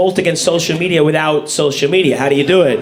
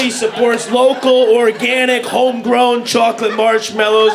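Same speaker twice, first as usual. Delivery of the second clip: higher, shouted mock outrage, slowed; hammed-up outraged ranter; 3 semitones higher, slower than usual. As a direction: rant like an online conspiracy crank. Impersonating ranting cereal poster.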